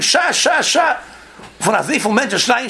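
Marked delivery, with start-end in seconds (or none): 0.0-0.9 raised, urgent sha sha; shouted shushing. Retold or imitated. The rebbe hushing the singing crowd, shouted sha. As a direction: call out to hush the room. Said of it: "און דער פלאגט שרייען".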